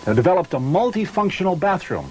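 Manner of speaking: smooth, measured sales pitch voice; ad voice-over read. Acted. Pitchman reading the RV promo to camera, smooth ad read. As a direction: present the product like a commercial.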